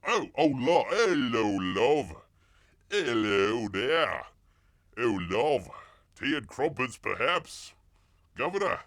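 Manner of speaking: loud, hammed-up Cockney caricature; bad Cockney English. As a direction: throw out stock phrases in overdone Cockney. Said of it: "best Cockney English".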